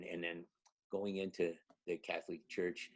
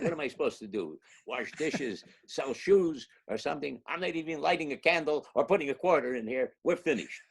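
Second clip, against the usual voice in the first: half-laughing mock scolding, louder and higher; telling off a saint; 7 semitones higher, 6 dB louder than usual. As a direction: scold him with a laugh breaking through. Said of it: "I tell Saint Jude off".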